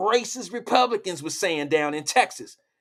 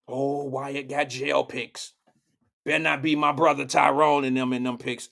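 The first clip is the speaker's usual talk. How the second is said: mock viewer reaction; 5 semitones lower than usual. Voicing a viewer worried about brother in jail pics, low mock voice. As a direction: drop low and drag it out like a suspicious onlooker.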